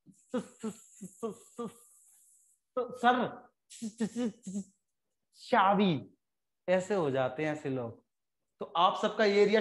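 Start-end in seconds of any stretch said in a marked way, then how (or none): 0.1-6.1 soft, stuck on the first sound, s- s- s-; imitating a stammer. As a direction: play a friend who can't get the word out. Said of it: "वो बात ही नहीं बोल पा रहा".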